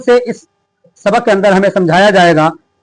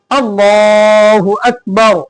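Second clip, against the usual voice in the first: slow, drawn-out call to prayer; muezzin's call; much slower than usual. Retold or imitated. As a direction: lightly intone it like a muezzin calling. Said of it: "मुअज्जिन पुकारता है". (मुअज्जिन की तरह हल्का सा खींचकर पुकारना)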